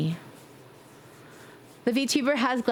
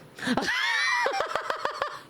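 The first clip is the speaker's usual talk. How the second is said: shrieking laugh. Real, not acted. Laughs at her story.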